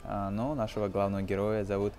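normal talking voice

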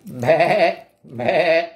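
wavering bleat like a sheep. Acted. Reader voices the sheep for children, bleating. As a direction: bleat it out in short bursts. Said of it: "bleeped the sheep".

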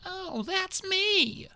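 very slow, drawn-out character voice; Peril's dawning realization. A narrator's reading. Peril overhears herself being accused, slow realization. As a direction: let the realization sink in slowly. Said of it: "Peril realized".

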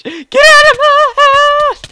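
drawn-out, very high squeal of mock outrage; high mock screech. Gamer ordering an opponent out of his house, shrieking.

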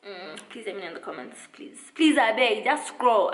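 usual speaking voice

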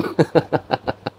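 short high scornful laugh; mocking laugh. Real, not acted. Vlogger scoffing at a rival's words, high laugh. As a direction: laugh at the quote.

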